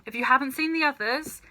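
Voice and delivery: plain reading voice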